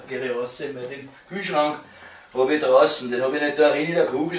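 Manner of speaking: ordinary talk, nothing marked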